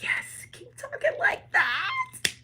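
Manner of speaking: very high, drawn-out mock squeal; delighted squeal. Spirits egging on the bold believer, delighted squeal. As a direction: squeal it slowly in a high put-on voice. Voicing the cheering spirits' reply.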